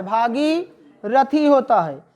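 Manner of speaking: Bhagirathi spelled out slowly, each syllable clear; slow dictation. Shown as a demonstration. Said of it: "तो भागीरथी लिखो". (धीरे-धीरे बोलकर लिखवाना)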